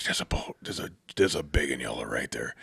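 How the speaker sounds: hushed, voiceless hunting whisper; urgent whisper. Retold or imitated. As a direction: whisper so the animal won't hear. Quietly alerting guide to nearby animal.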